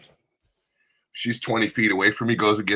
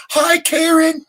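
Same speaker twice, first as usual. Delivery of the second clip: loud, high-pitched jeering hello; mocking call-out; 7 dB louder, slower than usual. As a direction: yell the greeting to embarrass her from afar. Re-enacts the call-out to strangers.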